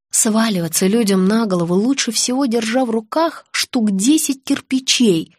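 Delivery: ordinary delivery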